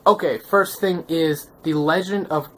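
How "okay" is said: normal talking voice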